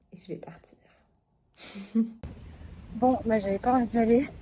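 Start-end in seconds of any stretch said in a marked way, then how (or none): 0.1-0.8 whispering. Real, not acted. Sneaking off to run.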